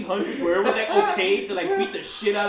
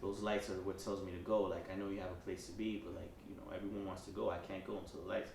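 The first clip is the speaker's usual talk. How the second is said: calm conductor; 12 semitones lower, 18 dB quieter than usual. Retold or imitated. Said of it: "He's like".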